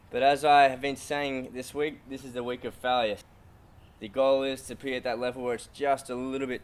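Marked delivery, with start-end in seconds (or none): none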